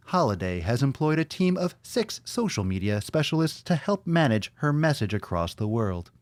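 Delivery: radio countdown host read; Casey Kasem. Acted. Host reading a Wikipedia page aloud, mock radio announcer. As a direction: deliver it in measured, swooping announcer phrases. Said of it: "Should I read it like Casey Kasem?".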